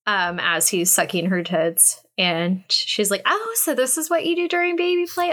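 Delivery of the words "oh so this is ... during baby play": smug. Retold or imitated. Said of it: "a little bit of smugness".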